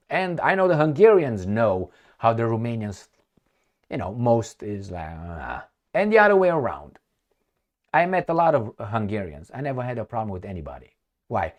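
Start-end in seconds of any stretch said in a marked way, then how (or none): none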